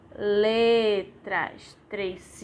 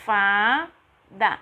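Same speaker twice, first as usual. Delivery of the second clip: "Fada" split as FA, pause, DA; syllable by syllable; 2 semitones lower, 4 dB louder than usual. Shown as a demonstration. Teacher having children repeat, "Fada" in two separate pieces.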